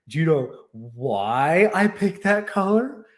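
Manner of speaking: slow, teasing put-on voice of the wife; imitating the wife. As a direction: draw it out like a playful wife with a secret. Wife voiced in retold story.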